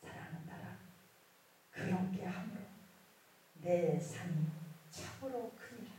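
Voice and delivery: hushed, measured divine utterance; voice of the Lord. Retold or imitated. The Lord speaks again to the daughter, hushed voice of the Lord. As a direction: quietly speak as the Lord to a loved one. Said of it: "그러면서 주님이 또 말씀하십니다".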